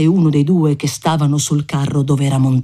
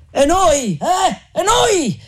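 much higher insistent cries; calling out; 12 semitones higher, slower than usual. Acted. Voices on the front cart demand the wine, calling out high and insistent. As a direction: shout from the front cart wanting a drink too. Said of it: "gridaron più voci dal carro".

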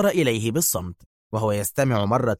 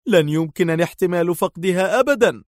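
higher-pitched cry of collapse, not muttered; distraught; 7 semitones higher than usual. A narrator's reading. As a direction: voice a man breaking down, pitched up. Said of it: "ويغمغم في انهيار".

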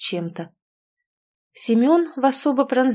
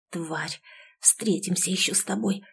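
feverish whispered curse; hoarse delirious whisper; 7 dB quieter than usual. A narrator's reading. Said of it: "как в бреду шептала она".

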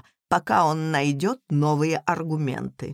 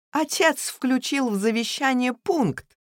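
ordinary delivery

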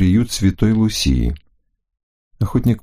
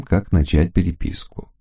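ordinary delivery, nothing marked

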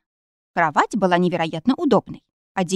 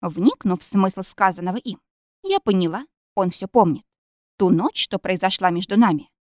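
ordinary delivery, nothing marked

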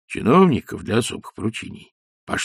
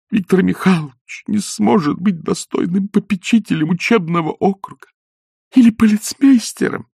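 горячий упрёк; 9 semitones higher than usual. Acted. Елена горячо упрекает гостей.

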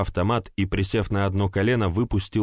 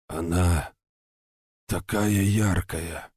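wheezing; slower than usual. Acted. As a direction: rasp it out with no breath left. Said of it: "просипел он".

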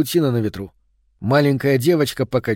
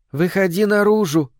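calling out; 6 semitones higher than usual. A narrator's reading. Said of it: "позвала она, улыбаясь".

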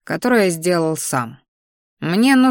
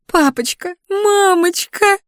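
much higher, slow, wailing fake cry; fake sobbing; 13 semitones higher, 4 dB louder, slower than usual. A narrator's reading. Spoiled daughter wailing to her parents, fake sobbing. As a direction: whine for parents like a spoiled child. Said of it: "наигранно зарыдала она".

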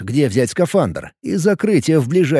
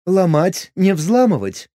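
smug smirk; 6 semitones higher than usual. Acted. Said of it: "хмыкнул дрон".

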